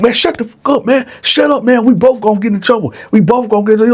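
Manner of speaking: high, fast, urgent hush; harsh hushing. Retold or imitated. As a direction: shut him up fast and hard. Attacker hushing victim.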